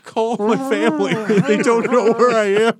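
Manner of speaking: high, loud hostage plea, laughing; panicked pleading. Pretend. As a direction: beg like a captive. Kidnapped Affleck pleading.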